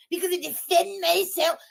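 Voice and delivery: high, put-on whiny imitation; mocking mimicry. Host parroting the woman's excuse, mocking.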